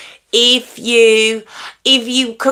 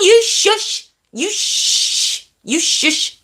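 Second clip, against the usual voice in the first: playful shushing; 11 semitones higher, much slower than usual. Real, not acted. Teasing a viewer to be quiet.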